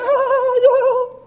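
high hidden giggle; a kid giggling behind a tree. Retold or imitated. Hidden kids giggling at dad, high giggle. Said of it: "this voice behind the tree going".

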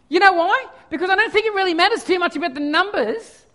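ordinary talk, nothing marked

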